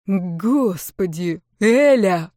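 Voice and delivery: drawn-out, slow exasperated moan; groaning. A narrator's reading. Husband pleads with his ex-wife, groaning. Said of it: "простонал Константин".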